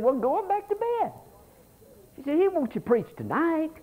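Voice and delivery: a mother soothing her son back to bed; mama's voice. Retold or imitated. Mother sending her little boy back to bed, high mock voice.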